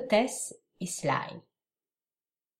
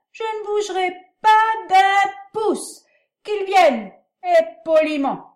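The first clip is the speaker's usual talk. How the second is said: put-on belligerent drunk; 9 semitones higher, 10 dB louder than usual. A narrator's reading. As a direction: roar defiance, slurring words. Drunk Sly defies the constable.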